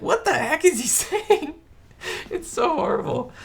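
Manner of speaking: high-pitched, cracking up while talking; laughing disbelief. Real, not acted. Fan ridiculing a terrible cowboy accent, laughing in disbelief. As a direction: squeal it through helpless laughter.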